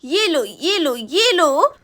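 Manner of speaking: slow, heavy punches on each phrase; angry scolding. Acted. Woman beats her cow as punishment, angry and drawn out. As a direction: land each phrase like a blow.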